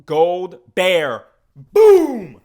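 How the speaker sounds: sudden loud high shout; excited shout. Real, not acted. Vlogger cheering the gold bear mascot with a loud shout. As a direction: blast it out with joy.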